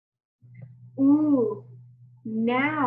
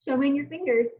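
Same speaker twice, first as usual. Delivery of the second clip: ordinary talk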